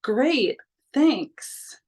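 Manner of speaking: high, sarcastic thanks dripping with disbelief; teen mocking her stepdad's insult with sarcasm; sarcastic retort. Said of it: "And I'm like".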